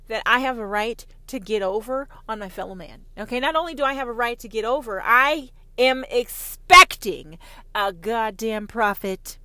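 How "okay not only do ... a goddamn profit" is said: normal talking voice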